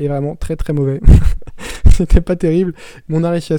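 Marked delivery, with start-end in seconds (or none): 1.0-1.9 a short, loud, breathy laugh; burst of laughter. Real, not acted. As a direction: laugh it off at the bad translation. Laughs at own joke on Google. (éclat de rire bref et fort)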